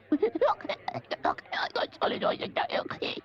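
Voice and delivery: whispered gibberish squeak; imitating squeaky voice. Stagehand imitating Toad's voice to Badger, whispered. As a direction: mimic Toad's lost voice in a breathy mumble. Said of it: "He's talking like this".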